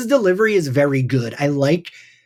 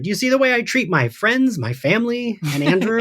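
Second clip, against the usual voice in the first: mock Buffy; 7 semitones higher than usual. Funny Buffy line quoted.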